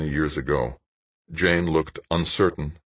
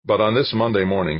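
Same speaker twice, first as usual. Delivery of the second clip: ordinary delivery, nothing marked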